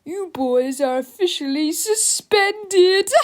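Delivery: slow, high, gloating announcement; delighted shout. A narrator's reading. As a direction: savor the sentence, drawing it out loudly. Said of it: "shouted with delight".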